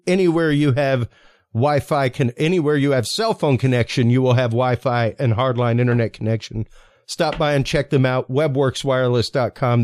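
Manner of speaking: ordinary talk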